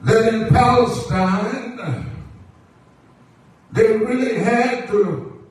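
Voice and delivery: projected sermon cadence in short, held phrases; preaching. Acted. Preacher assures the church that the Lord always provides, preaching. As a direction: stretch key words, then pause for the congregation.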